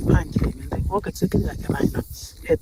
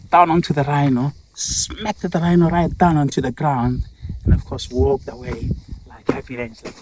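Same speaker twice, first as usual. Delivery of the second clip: ordinary delivery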